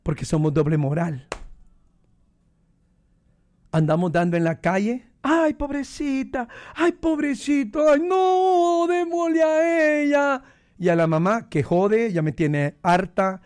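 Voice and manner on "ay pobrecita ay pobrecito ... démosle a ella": high, slow fake pitying coo; mock pity. Preacher mocking public hypocrites, high slow fake pity. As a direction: ham up the syrupy pity.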